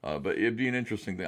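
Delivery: ordinary talk, nothing marked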